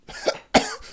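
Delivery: feverish sick cough; wounded man coughing; weak cough. A narrator's reading. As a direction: cough weakly. Coughing fit.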